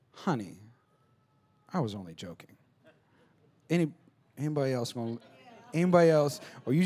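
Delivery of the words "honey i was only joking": lower, softer sheepish excuse; mock excuse. Husband brushing off his wife's hurt, sheepish and soft. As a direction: mumble it like a guilty husband. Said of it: "my theme song for my wife".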